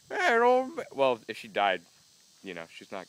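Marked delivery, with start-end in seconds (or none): 0.1-0.8 pretend old lady blaming. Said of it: "She'd be like".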